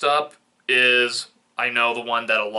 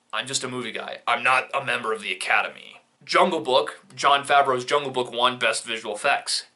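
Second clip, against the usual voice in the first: usual speaking voice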